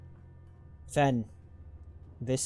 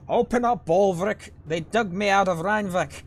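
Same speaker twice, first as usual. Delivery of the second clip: put-on loud, much higher yell to an old friend; 8 semitones higher, 7 dB louder than usual. Sigborn hailing Bolvrik at the city gates, yelling. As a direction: bellow up to the gate guard. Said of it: "he says before yelling".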